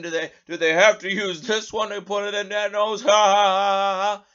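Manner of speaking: imitating a smug joking laugh; mocking laugh. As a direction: ham up their laughter. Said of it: "and they're laughing".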